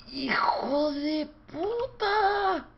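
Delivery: high, slow stretched-out curse; drawn-out furious yell. Real, not acted. Gamer howling a curse at the game. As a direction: drag the curse out in a howl.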